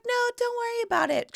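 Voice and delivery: high, quick, breezy; Janet waving it off. Retold or imitated. Janet tells her guest to keep the sweater, high and quick. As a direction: brush it off sweetly. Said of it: "She was like".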